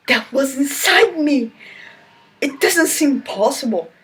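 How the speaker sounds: high, hammed-up disbelief; mock astonishment. Reader mocking the heroine's shock, high and hammed-up. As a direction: gasp it out like a scandalised reader.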